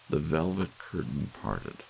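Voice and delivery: ordinary delivery, nothing marked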